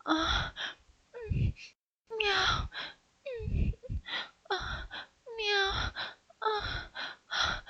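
high, soft, mewling meows; catgirl mewing. Acted. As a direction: moan like a pleased kitten. Catgirl moaning.

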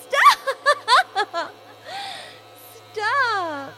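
high laughing pleas; being tickled. Real, not acted. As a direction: protest through tickle laughter. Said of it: "that's too much".

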